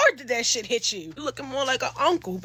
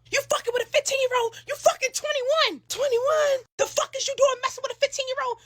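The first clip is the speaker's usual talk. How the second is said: much higher, outraged scolding; imitated furious yelling; 13 semitones higher than usual. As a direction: hammer him with disbelief. Woman scolds Noah, outraged.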